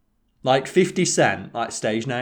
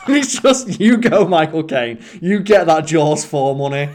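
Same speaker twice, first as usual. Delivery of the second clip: laughing cheer; 5 semitones higher, 7 dB louder, slower than usual. Real, not acted. Laughing while praising Caine.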